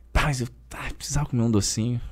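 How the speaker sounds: whispered guilty confession, speeding up; hushed confession. Real, not acted. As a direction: drop to a near whisper, then rush it. Confessing the sweet craving.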